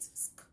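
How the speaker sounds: single quick voiceless SK; blended SK. Shown as a demonstration. Teacher showing learners how to blend it, SK as one sound. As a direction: run S straight into K. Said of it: "Blend it".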